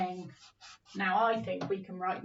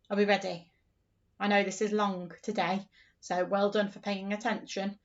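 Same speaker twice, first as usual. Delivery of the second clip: normal talking voice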